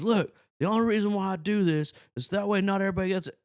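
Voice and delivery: high, put-on lecturing voice; tank boss. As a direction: act out a boss explaining himself. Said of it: "he comes in there, he goes".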